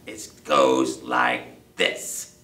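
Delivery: low, loud, very slow dog voice; insistent dog. Acted. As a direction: spell it out slowly and forcefully. Annoyed dog demonstrates the song.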